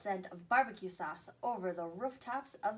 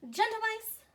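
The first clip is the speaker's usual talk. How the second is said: professor addressing; 12 semitones higher, 4 dB louder than usual. Acted.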